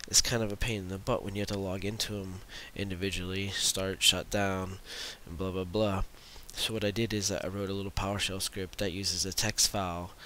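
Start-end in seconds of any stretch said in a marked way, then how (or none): none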